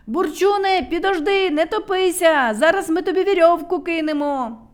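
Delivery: high, loud calling out; urgent shout. A narrator's reading. Neznaiko shouts to Burchun in the water. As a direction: call to the drowning friend. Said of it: "кричав Незнайко".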